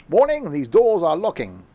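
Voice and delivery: hammed-up German accent for a door warning. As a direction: play a German train announcement in a thick German accent. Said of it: "in a German accent".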